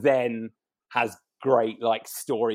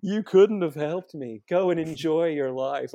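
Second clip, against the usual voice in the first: dreamy ghost voice; 4 semitones higher, slower than usual. Retold or imitated. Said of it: "or whatever she says".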